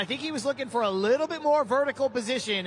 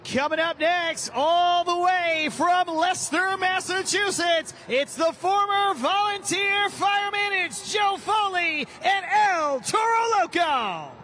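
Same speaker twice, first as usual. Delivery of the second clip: building to a slow booming shout; big arena driver introduction; arena announcer; 8 semitones higher, 4 dB louder than usual. Real, not acted.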